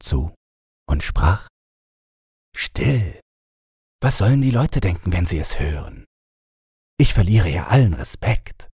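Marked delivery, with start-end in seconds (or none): 2.6-8.7 higher, hushed and hurried prince; hushing prince. A narrator's reading. Prince silencing his laughing wife, higher and hushed. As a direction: shush her, worried what people think.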